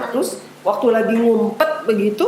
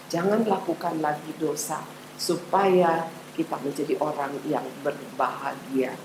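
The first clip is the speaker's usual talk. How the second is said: put-on softer, gentle closing plea to children; tender appeal; 4 semitones lower, 5 dB quieter than usual. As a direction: coax the children softly to let go of fear. Ajakan penutup kepada anak. (ajakan lembut dan pelan kepada anak-anak)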